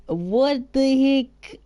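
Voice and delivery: high, very drawn-out bewilderment; baffled exclamation. Real, not acted. Streamer stumped by German words, high slow bafflement. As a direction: drag each word out in disbelief.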